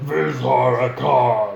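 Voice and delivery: loud, slow, gruff orc growl of a name. Shown as a demonstration. Naming an NPC for the players, in an orc voice. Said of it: "you have to say it in an orc voice".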